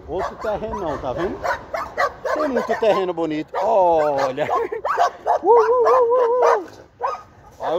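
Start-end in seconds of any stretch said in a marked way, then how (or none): 5.4-6.9 high, slow mock barks like the dogs; imitated dog barking. Vlogger mimicking the noisy dogs behind the gates, high slow barks. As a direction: bark back at them, high and drawn out.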